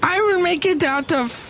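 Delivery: high, affected grand-lady voice; Madame Rutabaga. Pretend. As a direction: gush like a grand society madam. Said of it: "Madame Rutabaga".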